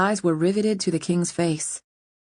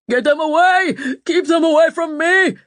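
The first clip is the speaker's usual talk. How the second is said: much higher, louder panicked babble; gibbering terror; 10 semitones higher, 6 dB louder than usual. A narrator's reading. King cowering from the ravens, gibbering terror. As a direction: shriek it, half mad with fear. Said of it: "the king gibbered".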